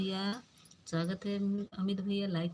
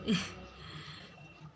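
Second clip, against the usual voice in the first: soft breathy laugh; 5 dB quieter than usual. Real, not acted. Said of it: "मुझे हंसी आ रही थी".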